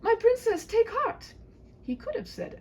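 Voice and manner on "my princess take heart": high, loud cry of protest; knight cries out. Acted. Knight crying out to the princess, high and loud. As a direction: cry out in dismay.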